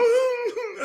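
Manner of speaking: very high imitated child's cry; mock child's wail. Host imitating a hungry child's wail.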